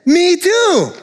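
gleeful me too; excited me too. Acted. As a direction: brighten with glee at the match. Joke, excited agreement.

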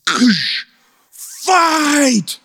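high desperate yells, each word hurled; desperate screaming. Retold or imitated. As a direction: scream at her to fight for her life. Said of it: "starts screaming in her face".

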